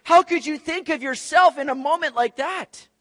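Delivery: high, loud outraged rebuke; indignant shout. Retold or imitated. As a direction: yell at the selfish friend. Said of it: "You'd just be thinking".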